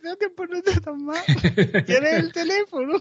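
high, squeaky mock woman's voice. Stranger keen on the dog trainer's price, high mock voice.

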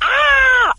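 grito agudo de película de miedo; grito de terror. Pretend. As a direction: chilla como víctima de terror. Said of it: "un efecto de sonido de esos de grito de terror". (grito de víctima de película de terror)

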